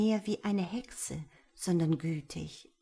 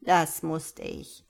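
put-on slow, composed old woman; lightly voiced elder, unhurried; old village elder; 2 semitones lower, 4 dB louder, much slower than usual. A narrator's reading. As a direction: answer calmly and deliberately. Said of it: "Die Alte lächelte freundlich".

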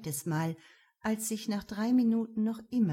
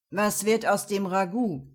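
loud sneering goat man; sly goat man voice; 7 dB louder than usual. Acted. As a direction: sneer the question slyly. Mechanic sneers at remains. (spöttische Ziegenstimme)

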